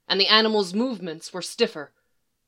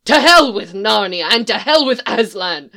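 louder raging cry, HELL punched; strangled outburst; 3 semitones higher, 10 dB louder than usual. Acted. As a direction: hurl it in grief and fury. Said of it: "The Fawn's voice was strangled".

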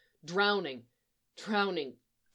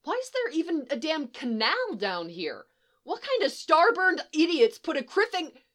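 high-pitched, agitated ranting yell; 9 semitones higher than usual. A narrator's reading. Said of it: "Han yells back".

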